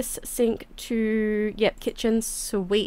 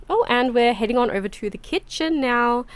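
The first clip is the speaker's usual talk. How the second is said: ordinary delivery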